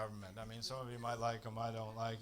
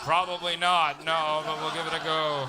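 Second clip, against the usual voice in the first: loud mock shrug; 3 semitones higher, 14 dB louder than usual. To the neighbours, a loud fake-hopeful shrug. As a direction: boom it out, pretending to care.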